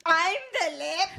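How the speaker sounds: high-pitched leprechaun holler, hammy Irish; yelled Irish accent. Acted. Trying the podcast host's Scottish yell, comes out Irish accent. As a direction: cry it out in a mock Irish accent. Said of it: "that's Irish still, not Scottish".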